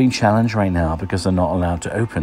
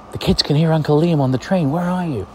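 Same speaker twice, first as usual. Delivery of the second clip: put-on excited kids; 9 semitones higher than usual. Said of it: "friends with the kids call me and go".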